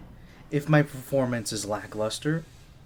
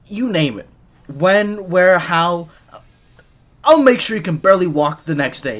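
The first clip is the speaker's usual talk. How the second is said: cocky bravado; 3 semitones higher, 8 dB louder than usual. Acted. Teasing boast to the Agent.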